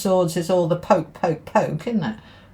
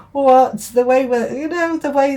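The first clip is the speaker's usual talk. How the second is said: outraged what; 6 semitones higher, 6 dB louder than usual. Real, not acted. Outrage at old parenting.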